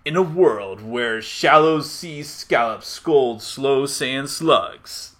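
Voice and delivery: slow, weighty trailer announcer; movie trailer voice. Shown as a demonstration. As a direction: announce it like an epic film trailer. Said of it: "speak in your best movie trailer voice".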